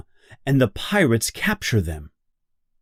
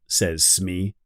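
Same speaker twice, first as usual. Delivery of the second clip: plain reading voice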